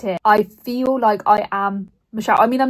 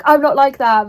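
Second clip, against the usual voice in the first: louder, higher denial; defensive; 5 semitones higher, 7 dB louder than usual. Retold or imitated. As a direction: brush it off defensively. Michelle voiced brushing off.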